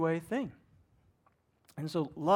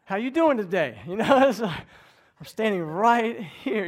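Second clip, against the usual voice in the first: louder, calling out with a laugh; loud neighbor greeting; 5 semitones higher, 10 dB louder than usual. Retold or imitated. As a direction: boom a hello across the yard. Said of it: "I'm like".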